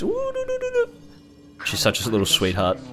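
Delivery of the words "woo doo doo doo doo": very high excited whimpering yips; dog running up happily, very high yips; happy dog noises. Retold or imitated. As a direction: squeal like a dog greeting a friend.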